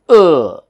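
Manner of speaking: UH as a long, loud, falling vowel; loud drawn "uh" with no R; upper stress. Shown as a demonstration. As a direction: push out a stressed "uh", sliding down. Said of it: "there is an upper stress".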